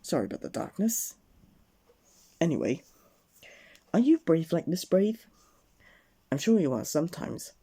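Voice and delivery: ordinary delivery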